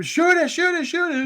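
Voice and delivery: high yelled panicked order; screaming. Retold or imitated. Roger screaming at Harold to shoot the monster, high and loud. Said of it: "Roger's screaming".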